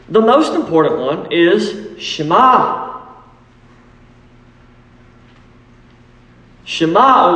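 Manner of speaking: "Shema" said shuh-MAH, stress on the end; Hebrew Shema. Shown as a demonstration. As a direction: give "Shema" its Hebrew sound, landing on MAH. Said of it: "Shema Hebrew word".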